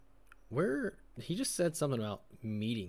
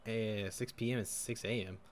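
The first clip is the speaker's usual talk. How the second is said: ordinary delivery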